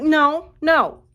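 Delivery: high, loud flat refusal; firm refusal. Retold or imitated. Refusing the man's Hawaii plan, high and loud. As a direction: shut him down with two high nos.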